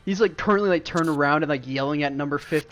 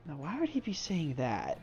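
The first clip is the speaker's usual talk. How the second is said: puzzled murmur; 10 dB quieter than usual. Acted. Dim guard puzzling over the threat, softly. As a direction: puzzle over it quietly to yourself. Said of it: "kinda is like".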